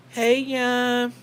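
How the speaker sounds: loud, high, drawn-out hey; called-out greeting. Real, not acted. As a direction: sing out a hello to someone arriving. Greets another viewer joining the live.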